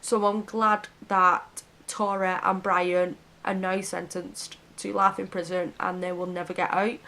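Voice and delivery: ordinary talk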